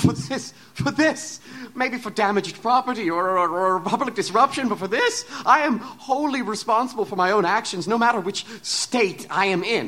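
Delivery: high, loud, laughing protest; rising to an agitated pitch; agitated outburst. Acted. Doctor protests his responsibility.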